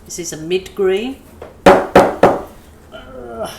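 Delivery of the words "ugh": loud straining grunt; effort grunt. Real, not acted. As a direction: grunt while twisting a lid. Lid won't come off.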